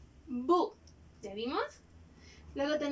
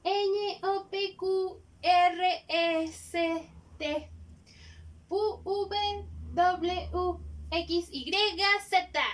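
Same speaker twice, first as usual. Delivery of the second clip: teacher calling out letters clearly; alphabet recitation; 4 semitones higher, much slower than usual. Shown as a demonstration. Teacher to pupils, reciting the alphabet letter by letter. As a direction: recite each letter for the class.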